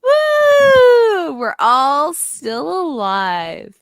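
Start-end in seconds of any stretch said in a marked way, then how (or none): 0.0-1.4 long high "woo"; excited whoop. Real, not acted. Co-host celebrating another week survived, excited whoop. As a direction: let out a drawn-out cheer.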